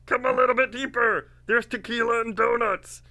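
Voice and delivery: very high, loud luring call; George Clooney impression. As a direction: beckon in a badly faked high celebrity voice. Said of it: "And he's like".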